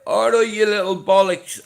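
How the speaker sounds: high, loud cheeky Dublin greeting; play-rough grandad hailing the baby; Dublin granddad greeting. Retold or imitated. Granddad greeting his baby grandson, loud cheeky Dublin hello. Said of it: "I'm like that".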